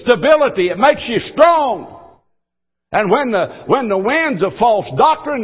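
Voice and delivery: full-force revival preaching; fiery preaching. Acted. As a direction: drive the sermon home with fervor.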